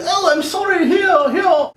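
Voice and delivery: high, put-on lady voice; mock-apologetic high voice; apologetic clerk lady. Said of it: "not the same lady who was there before".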